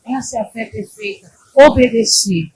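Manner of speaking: ordinary delivery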